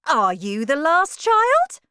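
loud, rising, high-pitched asking; astonished question. A narrator's reading. Wonka asking Charlie if he is last, astonished. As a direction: ask it in eager amazement.

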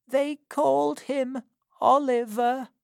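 high crone voice, fading; an old woman with little strength left; weakly. A narrator's reading. Old Sally naming the baby to Missus Corney, weakly. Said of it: "replied the old woman weakly".

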